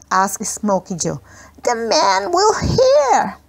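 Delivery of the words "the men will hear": put-on high, slow, tremulous child's question; small boy voice. A narrator's reading. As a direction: ask it like a scared little boy, drawn out. Smokey Joe, worried child.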